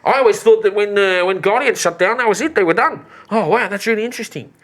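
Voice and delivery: high, put-on voice full of wonder; fascinated shopkeeper. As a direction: muse with interest, pitched well up.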